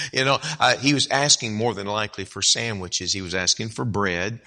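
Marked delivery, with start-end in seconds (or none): none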